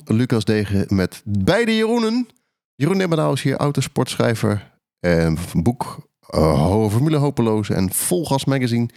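ordinary talk, nothing marked

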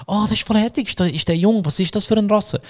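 high, cooing questions; gushing passer-by. Retold or imitated. Passers-by asking about the dog, cooing. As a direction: coo like a curious passer-by. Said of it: "Leute auf der Straße".

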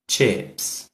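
Chips said clearly in English, softly; English word said slowly. Shown as a demonstration. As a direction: spell it out, then say Chips. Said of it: "C H I P S". (Chips pronunciado em inglês, devagar e baixo)

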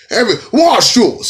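high, loud mock dancehall holler. Comic parodies singer's ad-libs, mock high shout. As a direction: shout it as a hammed-up hype call. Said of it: "We want [name]".

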